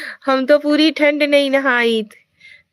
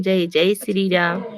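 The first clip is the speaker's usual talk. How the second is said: ordinary delivery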